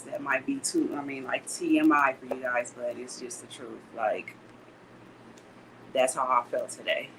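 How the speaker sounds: usual speaking voice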